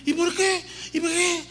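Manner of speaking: put-on high, whining child asking why; high-pitched kid voice nagging why; whiny child. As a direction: mimic a child pestering why. Child questioning parent's order.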